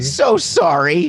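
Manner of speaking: sarcastic pleading; mock apology. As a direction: drag out a fake sorry. Mocking the repeated apology.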